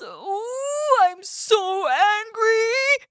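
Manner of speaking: growling angry bear. Acted. Bear stung by the bee, slow angry growl. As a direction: fume at the bee. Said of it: "growls Mister Bear".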